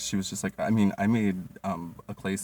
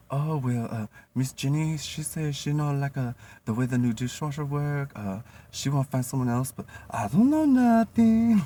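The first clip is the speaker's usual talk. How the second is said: higher voice, building louder at the end; imitating the gossiping cook; 7 semitones higher, 6 dB louder than usual. Cook Kane gossiping to the waitress, mock innocent. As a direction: gossip innocently, then protest loud.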